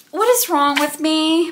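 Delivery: high, dismayed self-questioning; exasperated self-reproach. Real, not acted. Book buyer scolding herself over a duplicate, high dismay. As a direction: wail lightly at your own folly.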